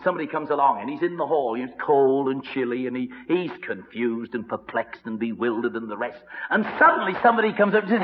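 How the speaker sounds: usual speaking voice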